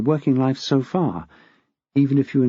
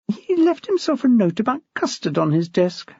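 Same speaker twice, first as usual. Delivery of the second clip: a touch higher, a hint of a worried young teacher; lightly voiced young woman; 8 semitones higher than usual. A narrator's reading. Anxious teacher reporting to the head, lightly voiced. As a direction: voice Nicole a little higher than the narration. Nicole's voice in the story.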